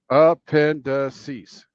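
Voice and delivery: "appendices" very slowly, syllable by syllable; demonstrated pronunciation. Shown as a demonstration. Co-host reads out the AI's pronunciation, "appendices" slowly sounded out. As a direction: sound out "appendices" slowly and firmly, ending in "seez". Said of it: "The pronunciation is".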